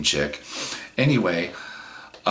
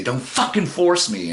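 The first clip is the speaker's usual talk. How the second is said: angry; 7 semitones higher than usual. Retold or imitated. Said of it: "I get mad and I get violent".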